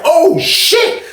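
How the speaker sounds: high laughing oh shit; startled laugh at the knife; laughing alarm. Retold or imitated. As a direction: laugh out the shock. Speaker reacting to big knife.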